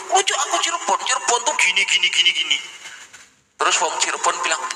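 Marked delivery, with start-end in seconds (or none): 0.1-2.6 high, brash mock boast; boastful fake Cirebonese. False Cirebon claimant bragging to a local, higher brash voice. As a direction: show off a fake hometown.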